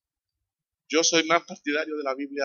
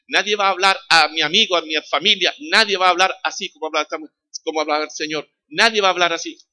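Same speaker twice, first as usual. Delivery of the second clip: higher and louder repetitions of "nadie"; emphatic preaching; 4 semitones higher, 6 dB louder than usual. Real, not acted. Preacher to congregation, emphatic repeated refrain. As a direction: pound the refrain home.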